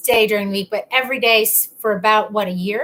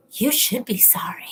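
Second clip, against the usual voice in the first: whispered, softer mock scolding; mom's attitude; 5 dB quieter than usual. Shown as a demonstration. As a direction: whisper it like an offended mother. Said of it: "I could always tell my mom's attitude".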